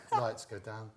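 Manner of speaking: usual speaking voice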